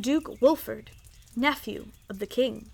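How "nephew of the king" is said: usual speaking voice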